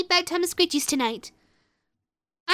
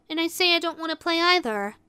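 ordinary talk

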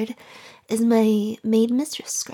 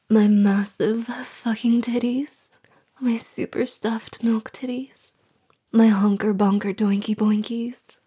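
sexy voice. Pretend. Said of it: "try it again with sexy voice".

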